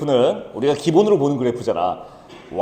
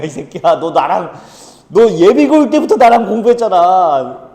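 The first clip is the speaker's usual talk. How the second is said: mock scolding tutor; 3 semitones higher than usual. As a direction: scold him like a fed-up tutor. Tutor scolding student in skit. (답답한 과외 선생님이 학생을 나무라듯)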